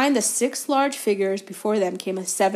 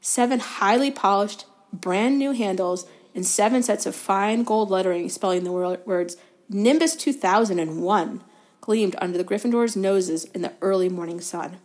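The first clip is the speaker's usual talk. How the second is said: plain reading voice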